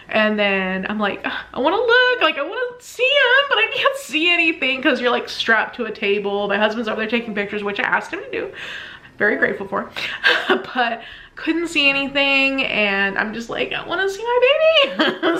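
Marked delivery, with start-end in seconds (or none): 1.2-4.8 high-pitched, insistent mock whine; pleading whine. Strapped down, wanting to see baby. 13.7-15.4 high, childlike mock pleading; pleading whine. Pleading to see her newborn.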